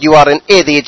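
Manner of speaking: ordinary talk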